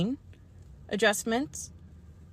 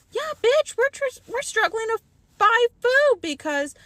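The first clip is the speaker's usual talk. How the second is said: exasperated rant; 6 semitones higher than usual. Real, not acted. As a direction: vent it, raised and sharp. Ranting about being broke.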